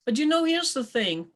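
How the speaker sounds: ordinary delivery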